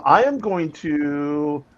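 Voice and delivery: ordinary talk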